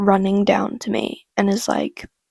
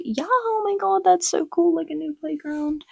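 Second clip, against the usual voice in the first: put-on excited kids; 8 semitones higher, 4 dB quieter than usual. Kids hearing about a new playground, excited. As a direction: imitate thrilled kids, pitch soaring then easing down.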